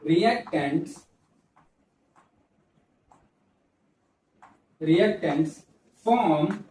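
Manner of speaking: word-by-word classroom dictation, long gaps; slow dictation. Real, not acted. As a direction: dictate slowly while students copy it down. Dictating a definition for copying.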